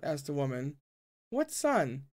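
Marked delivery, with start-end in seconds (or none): 1.3-2.1 put-on high girl voice. Courtesan voiced by reader.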